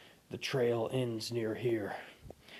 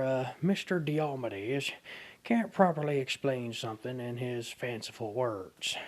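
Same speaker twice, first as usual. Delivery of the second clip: hammed-up cowboy accent; cowboy specialist voice; 3 semitones higher, 5 dB louder than usual. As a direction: play a folksy country expert. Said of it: "would you mind taking over from here".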